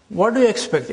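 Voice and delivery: usual speaking voice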